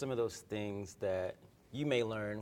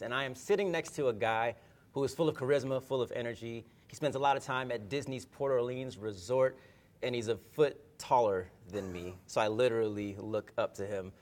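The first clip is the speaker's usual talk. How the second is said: ordinary talk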